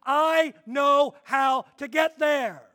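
loud, slow, stubborn husband; mock-stubborn shout. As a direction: dig in like a husband who won't ask directions. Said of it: "he says".

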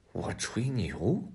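quiet, slow disbelief; hushed doubtful echo; taken-aback tiger. A narrator's reading. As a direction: draw the question out softly, incredulous.

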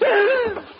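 high, loud shriek of horror; horrified gasp. Acted. Man seeing what dripped into his hand, horrified gasp. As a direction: gasp aloud in sudden horror.

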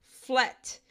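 teacher echoes 'Flat' for students; British English word. Shown as a demonstration. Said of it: "a gente fala flat".

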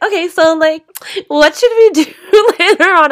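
high, loud, perky planning voice; mock a hangry person instantly revived by food; suddenly chipper. Mood flips after two bites.

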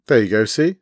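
mildly pleased with himself; satisfied. Acted. Takin answering Jennifer, mildly satisfied. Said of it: "said Takin with satisfaction".

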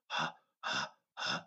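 breathless unvoiced huffing, no voice; imitated panting. Imitating the runner she heard, quick breathless huffs. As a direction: puff out quick panicked breaths. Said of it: "all she heard was".

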